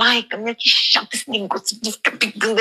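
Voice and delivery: mock grumbling to a trainer; light, put-on moaning about exercise; retold complaining. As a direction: play up a petty gripe. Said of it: "Aí já tava reclamando".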